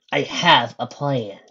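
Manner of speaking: slow reveal. Acted.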